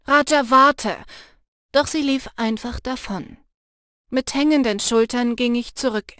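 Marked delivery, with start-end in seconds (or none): 0.1-1.0 lauter Ruf. Acted. Ruft der davonlaufenden Freundin nach.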